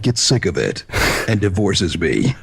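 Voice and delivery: slow, low villain voice in the throat; put-on demon-like chest voice. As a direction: chat playfully as a cartoon villain. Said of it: "We should talk like this".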